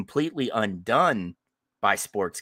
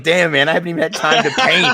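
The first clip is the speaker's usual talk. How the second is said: high, loud, laughing voice for his buddy; laughing mock quote; 7 semitones higher, 11 dB louder, faster than usual. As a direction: play Scott's complaint through a laugh. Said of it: "Scott's just like".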